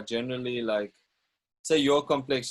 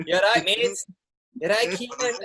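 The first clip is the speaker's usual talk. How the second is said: higher, louder playful Scouse impression; Scouse accent; 8 semitones higher, 3 dB louder than usual. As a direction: put on a cheeky Liverpool voice. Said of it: "When you went to Liverpool".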